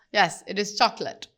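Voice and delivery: usual speaking voice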